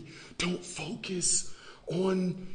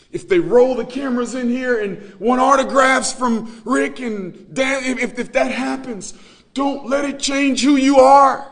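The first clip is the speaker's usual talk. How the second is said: louder and higher into a full preaching shout; building shout; 8 semitones higher, 14 dB louder than usual. Real, not acted. Preacher exhorting the congregation, building to a shout. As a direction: ramp up till you're shouting the warning.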